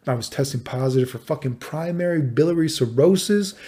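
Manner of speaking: normal talking voice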